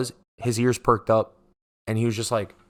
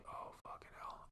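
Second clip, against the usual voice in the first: under-the-breath whisper; whispered curse; 30 dB quieter than usual. Retold or imitated. Dreading a fight as his sister confronts the cutter, whispering. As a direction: hiss the curse so nobody hears.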